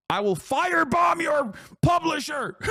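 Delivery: hammed-up high shout; mock outraged threat. Streamer imitating angry gamers threatening the publisher, mock furious shout. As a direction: shout the threat like a ranting zealot. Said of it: "they just straight up are like".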